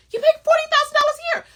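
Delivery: high, loud disbelieving jab; mock outrage at a friend's nerve; incredulous retort. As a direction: shriek it in his face. Retold retort to her friend.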